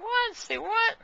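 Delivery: high-pitched, disbelieving reenactment; incredulous retort. Retold or imitated. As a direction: act out a startled double take. Acting out baffled reaction to odd message.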